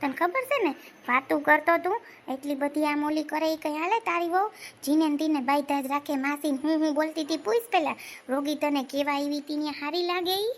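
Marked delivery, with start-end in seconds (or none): none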